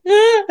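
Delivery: a high, shaky little cry; mock teary sigh; overwhelmed ah. Shown as a demonstration. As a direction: show how close to tears you were. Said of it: "a little bit like this".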